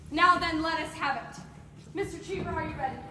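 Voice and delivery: usual speaking voice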